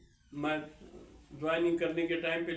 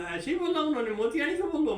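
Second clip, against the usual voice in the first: much higher and louder, an outraged demand; angry boss; 11 semitones higher, 5 dB louder, faster than usual. Retold or imitated. Feared headquarters boss angrily questioning the juniors, high and loud. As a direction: bark it back at them in disbelief.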